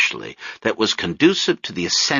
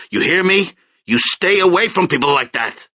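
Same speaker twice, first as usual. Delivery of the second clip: higher, louder lecture; father's higher, louder telling-off; angry scolding; 9 semitones higher, 4 dB louder than usual. Acted. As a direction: lay down the law to his daughter.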